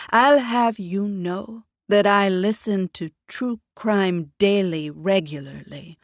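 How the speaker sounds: a mother lecturing with prim self-assurance; proud mother. A narrator's reading.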